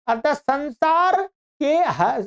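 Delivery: normal talking voice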